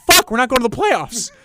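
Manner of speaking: high-pitched cursing cry; mock anguished yell; acted dismayed outburst. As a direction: blurt it as the bad news sinks in. Acts out his delayed realization.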